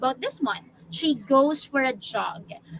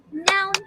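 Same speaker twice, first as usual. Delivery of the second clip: "noun" as one single beat; one-syllable beat; 6 semitones higher than usual. Shown as a demonstration. Teacher claps a one-syllable word for class, single beat. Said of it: "That's one syllable only".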